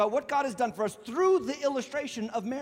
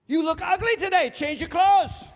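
blunt spouse; 8 semitones higher, 6 dB louder than usual. Retold or imitated. Honest spouse criticising outfit.

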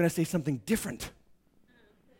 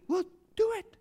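mock permission. Mocking worldly counsel.

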